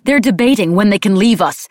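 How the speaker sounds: loud and high with outrage; indignant, heated report; spat out. A narrator's reading. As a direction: spit it out as an accusation. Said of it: "I spat".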